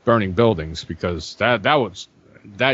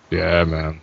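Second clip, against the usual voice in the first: ordinary delivery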